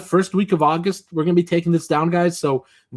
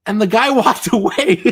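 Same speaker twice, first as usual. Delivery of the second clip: laughing; 8 semitones higher than usual. Real, not acted. Laughing at his own con story.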